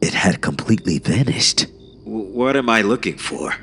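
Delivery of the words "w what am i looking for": lightly voiced officer, pitched up; a hesitant, higher-pitched question; puzzled officer. A narrator's reading. Officer sees no door, mildly puzzled. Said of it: "the officer asked me".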